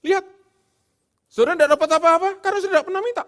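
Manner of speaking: very high, loud, fast mock preacher; hammed-up fast false teacher; mock prosperity preacher. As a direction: rattle it off like a hyped prosperity preacher. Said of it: "jangan diartikan".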